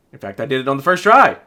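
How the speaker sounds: usual speaking voice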